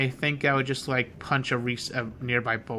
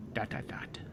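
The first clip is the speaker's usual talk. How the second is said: whispering; 3 semitones lower, 10 dB quieter than usual. Shown as a demonstration. Reading ellipsis in dialogue.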